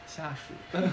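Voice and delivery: ordinary talk, nothing marked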